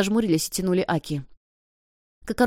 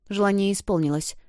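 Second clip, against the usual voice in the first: usual speaking voice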